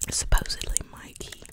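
ordinary talk, nothing marked

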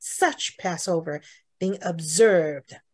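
slow read leaning on "such Passover"; slow stressed reading. Shown as a demonstration. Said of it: "such Passover可以重读". (慢读，重读"such Passover")

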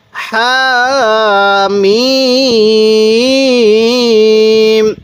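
very slow, with the vowels held long; elongated recitation. Shown as a demonstration. Teacher showing viewers how to elongate, held long. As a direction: sing out Hamim with the long madd. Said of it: "তো টানটা কিভাবে দিবেন".

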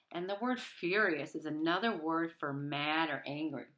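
ordinary delivery, nothing marked

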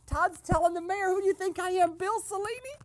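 high, loud, cracking up; gleeful laughing retelling. Pretend. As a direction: relay the quote through rising laughter. Relaying the gossip, cracking up.

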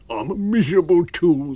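high, loud wretched whine; miserable tramp. A narrator's reading. Tramp to his unseen master, miserable.

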